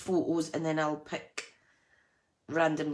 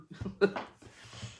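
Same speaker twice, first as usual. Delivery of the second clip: witchy cackle; 4 semitones higher than usual. Real, not acted. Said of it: "sounds like a right witchy laugh".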